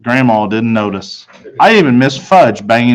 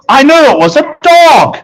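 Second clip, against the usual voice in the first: much higher, louder burst of joy; light up at the puppy; thrilled boy; 12 semitones higher, 5 dB louder than usual. Acted. Peter feeling the furry puppy in the box, thrilled.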